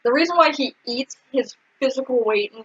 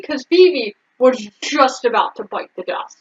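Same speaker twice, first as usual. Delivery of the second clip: ordinary talk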